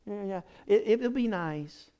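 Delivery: half-hearted polite shrug; mildly mock uninterested voice; lukewarm. Mocking lukewarm gift reaction.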